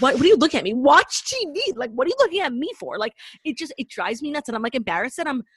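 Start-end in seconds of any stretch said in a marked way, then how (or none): 0.0-3.0 annoyed retort. Retold or imitated. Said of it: "Like, I just".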